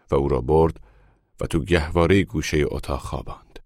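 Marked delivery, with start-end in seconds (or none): none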